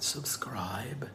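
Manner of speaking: "subscribe" stretched slow and soft; mock your own "subscribe", drawing it out quietly; odd drawn-out subscribe. Shown as a demonstration. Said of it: "why I said it like that".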